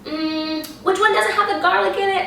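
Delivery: high-pitched friend voice. Retold or imitated. Friend asks about the garlic.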